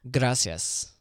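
"Gracias" with a half-trilled R; half-rolled R. Shown as a demonstration. Still can't roll R's, tries "Gracias" with a half-rolled R. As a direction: attempt a rolled R on "Gracias" and only get halfway. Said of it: "sounds like you're half rolling your".

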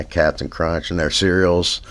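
usual speaking voice

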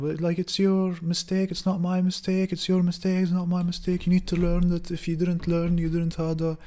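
high, loud lecturing of the roommate; scolding the roommate about his mistake, loud and high; heated lecture. Retold or imitated.

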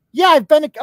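high, loud, over-eager interjection; mock eager boasting. Streamer imitates the nurse bragging about travel, over-eager. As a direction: jump in loud and high to one-up them.

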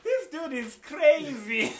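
slow, high, loud laughing words. Real, not acted. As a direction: laugh through the words in disbelief. Laughing at the other host's claim.

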